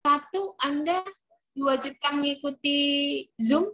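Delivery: choppy breaking-up call audio; glitchy jumping pitch. Real, not acted. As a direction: voice cutting in and out. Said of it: "suaranya putus-putus".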